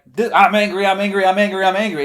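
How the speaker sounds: loud, high, relentless angry shout, same pitch every time; one-note angry shouting. Shown as a demonstration. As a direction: hammer the same anger over and over, yelling.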